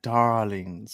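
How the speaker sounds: low, drawn-out sultry darlings; breathy vintage purr. Shown as a demonstration. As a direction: purr the word like a diva. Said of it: "You've gotta say it like".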